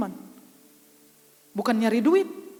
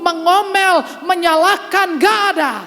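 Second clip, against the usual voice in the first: loud, slow, high preaching voice; fervent preaching; 7 semitones higher, 8 dB louder than usual. Acted. Preacher booming slowly to the congregation, fervent.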